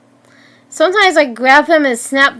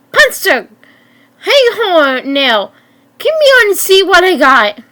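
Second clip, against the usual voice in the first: higher-pitched call to the gang; 6 semitones higher, 3 dB louder than usual. A narrator's reading. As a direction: call them over, pitched up. Villain calls out to his gang.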